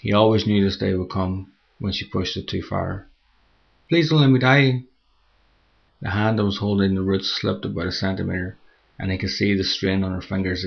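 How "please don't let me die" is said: high, loud fearful plea; terrified begging. Acted. Woman slipping off a cliff, terrified pleading. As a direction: beg him not to let you die.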